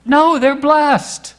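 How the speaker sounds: high and loud, booming a firm no; God speaking to Balaam, loud and forbidding; God's stern command. Acted. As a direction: thunder down the refusal as God.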